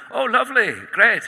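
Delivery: high, loud Scottish impression of an effusive actor; Scottish accent. Actor greeting an awkward fan, high Scottish accent. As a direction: exclaim it brightly in a Scottish accent. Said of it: "ten out of ten for the Scottish accent".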